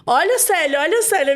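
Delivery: high, excited call to look; neighbour's urging, imitated. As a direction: urge her to look, acting the neighbour. (imitando o vizinho empolgado)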